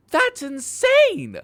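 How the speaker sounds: high, loud, slow outraged cry of insane; shocked shout. Real, not acted. Ranting to viewers about wasted time, high shocked shout. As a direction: stretch out insane in a high shriek.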